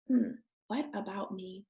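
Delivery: ordinary delivery, nothing marked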